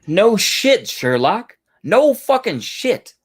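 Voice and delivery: high, dragged-out sneer at the obvious; mock him like he just discovered fire; sarcastic scorn. Real, not acted. To the man in the clip, drawn-out high sarcasm. Mocking the clip's obvious point.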